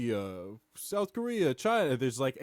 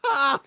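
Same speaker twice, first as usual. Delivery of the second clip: high, loud mock scream; sudden comic shriek; 10 semitones higher, 9 dB louder than usual. Acting out pressing the cancer button.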